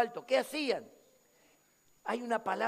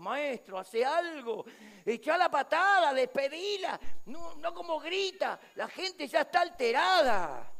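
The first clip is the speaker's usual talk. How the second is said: raised, loud, fed-up urging; agitated complaint; 6 semitones higher, 5 dB louder than usual. Retold or imitated. Disciples to Jesus about the shouting woman, agitated complaint. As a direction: press the master to get rid of her. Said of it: "Los discípulos se alteraron".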